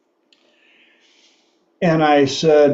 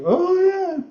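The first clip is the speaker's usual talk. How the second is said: high, drawn-out oh yeah of recognition; knowing oh yeah. Retold or imitated. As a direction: catch on with a knowing squeal. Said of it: "even the daughter was like".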